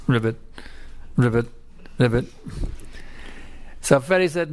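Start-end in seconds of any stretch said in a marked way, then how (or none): 0.1-2.3 frog croak. Acted.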